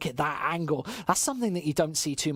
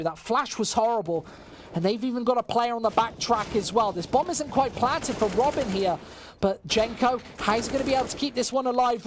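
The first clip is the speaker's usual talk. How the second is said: excited, higher-pitched esports commentary; live play-by-play; 7 semitones higher than usual. Acted. Caster calling a tense retake to viewers, excited play-by-play. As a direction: call the round with rising urgency, then land it.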